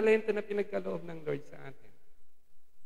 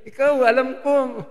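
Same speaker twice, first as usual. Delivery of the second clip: accusing servant; 7 semitones higher, 6 dB louder than usual. Retold or imitated. Servant accuses his master.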